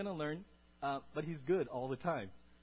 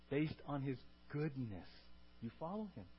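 hushed emphasis; 2 semitones lower, 8 dB quieter than usual. Shown as a demonstration.

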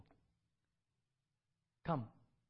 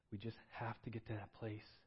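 ordinary talk, nothing marked

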